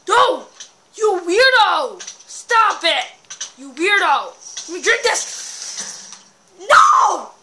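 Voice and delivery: loud, high panicked shouting; frantic yelling. Real, not acted. Player attacked mid-potion, yelling at the weirdo. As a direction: scream at the attacker to stop. Opponent attacks while he drinks.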